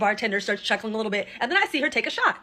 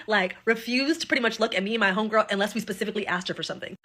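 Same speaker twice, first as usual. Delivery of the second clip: normal talking voice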